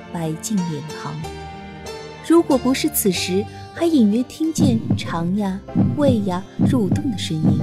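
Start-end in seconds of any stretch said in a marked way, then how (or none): none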